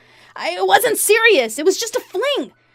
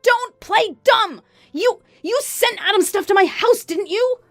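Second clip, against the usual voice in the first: high, loud, screechy accusation; enraged mistress; 12 semitones higher, 4 dB louder, slower than usual. Acted. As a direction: screech at her over the phone.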